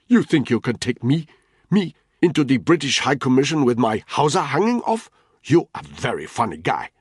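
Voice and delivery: put-on loud, high sneering Nigerian accent; mocking scorn. Ntoga mocking Danny's gun threat, sneering. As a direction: laugh him off with contempt.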